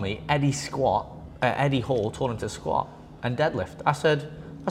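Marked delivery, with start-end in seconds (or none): none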